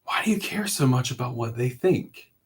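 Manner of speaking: soft, reassuring therapist voice; lightly voiced gentle therapist. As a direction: talk him down softly, like a kind therapist. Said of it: "And he was like".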